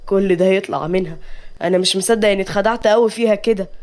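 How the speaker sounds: ordinary delivery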